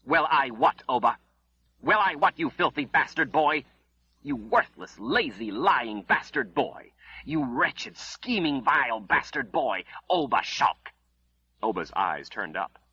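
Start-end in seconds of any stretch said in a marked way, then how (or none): 0.0-10.9 raised, higher insults hurled at him; furious berating. A narrator's reading. Mother cursing her lying son, furious and raised. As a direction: scold him with rising fury.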